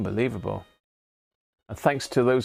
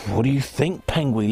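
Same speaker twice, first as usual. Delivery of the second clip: usual speaking voice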